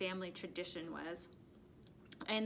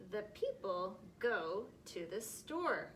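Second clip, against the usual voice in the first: slow, deliberate sentence reading; slow reading aloud; slower than usual. Shown as a demonstration. Teacher reading the next sentence slowly. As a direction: read it slowly for the class. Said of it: "let's read the next one".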